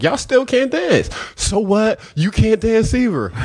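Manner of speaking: high, playful trash talk; trading trash talk with the girls, high and playful; teasing banter. Retold or imitated. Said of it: "I asked them".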